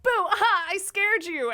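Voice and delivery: high, loud, smug taunt; gloating boo. Retold or imitated. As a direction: jump out crowing over the scare. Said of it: "pop up in front of me and be like".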